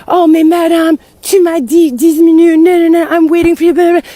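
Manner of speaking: loud, high-pitched rant imitating an irate caller; mimicked yelling. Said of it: "Starts yelling at me".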